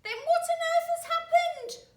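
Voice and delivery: put-on high, loud, panicked old woman; crying old wife. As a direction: wail it in alarm. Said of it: "cried Missus Twit".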